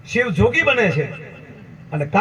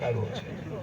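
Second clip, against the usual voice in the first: hushed mocking aside; 7 semitones lower, 15 dB quieter than usual. Trees snickering softly at the forest officer, hushed. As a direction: drop to a soft, far-off murmur.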